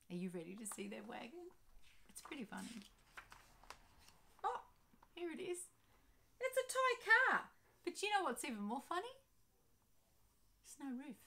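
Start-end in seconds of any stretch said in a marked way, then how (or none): 0.1-2.8 whispered aside. Real, not acted. Aside to child. 4.4-7.5 delighted reveal. Real, not acted. Showing the picture.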